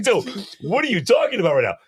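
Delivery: high-pitched incredulous protest; mock bewilderment. Gamer to his panicking roommate, high mock exasperation. Retold baffled reply to paranoid roommate.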